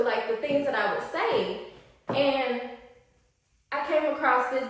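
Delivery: ordinary delivery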